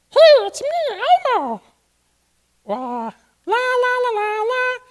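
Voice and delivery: very high puppet voice, up in the throat; sing-song la-las and greeting in falsetto; Elmo. Shown as a demonstration. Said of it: "You have to talk up here".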